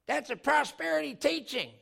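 high, loud, slow objection; accusing heckler. Acted. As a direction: call out an accusation from the crowd.

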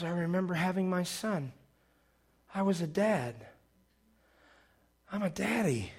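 very soft, hushed recollection; tender realisation. Retold or imitated. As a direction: say it quietly as it dawns on you. [name] quietly recalls becoming a father.

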